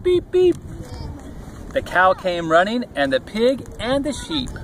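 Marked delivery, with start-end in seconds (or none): 0.0-0.6 high-pitched little beeps; high repeated toy-truck beep; truck horn beeps. A narrator's reading. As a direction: imitate a small horn calling. Echoing the truck's distress beeps.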